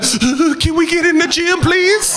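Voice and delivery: high-pitched, stretched polite plea; pleading relatives. Acted. Acts out family begging at gym.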